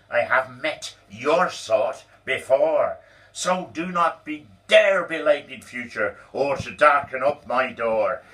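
loud, high furious rant; lost control. Acted. Boss throwing Donald out, furious shouted rant. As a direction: boss losing it, yelling at full volume. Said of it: "he lost his self-control".

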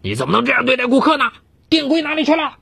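high, fast voiced manager berating; scolding. A narrator's reading. Manager scolds the clerk before customers, angry and fast. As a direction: boss dressing down an employee. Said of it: "一顿臭骂".

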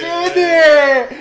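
laughing shout. Real, not acted.

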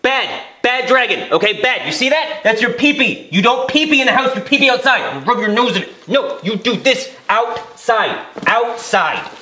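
scolding a pet through laughter; harsh barking commands. Acted. Scolding dragon again, laughing.

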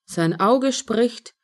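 ordinary delivery, nothing marked

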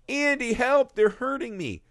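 high pleading doll voice; mock doll cry for help. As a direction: squeak for help like a scared toy. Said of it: "He's like".

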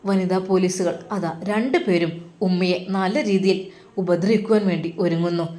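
ordinary delivery, nothing marked

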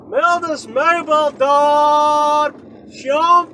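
high, very drawn-out call; playful calling out. Pretend. Driver answering own question about Frieskelo, calling out. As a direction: stretch the name out like a silly holler. Silly call-out of store name.

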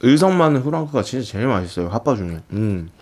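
chewing between words; talking while chewing. Real, not acted. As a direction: mouth full of sausage. Seen 1: bit sausage at -5, cheeks full, chewing while talking.

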